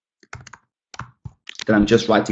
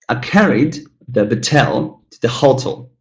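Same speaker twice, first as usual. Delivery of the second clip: "Batel" stressed on TEL, "hotel" on HO; wrong word stress. Shown as a demonstration. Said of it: "say this sentence".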